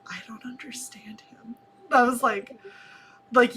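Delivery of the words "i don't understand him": hushed mock confusion; tiny whispered complaint from the audience; baffled whisper. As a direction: whisper it like a lost viewer. Voices baffled people in chat.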